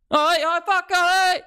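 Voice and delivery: very high, strained mock shout; overexcited competitor losing it; hammed-up frantic yelling. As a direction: yell it at the top of your range. Worked-up toy rivalry, acted out.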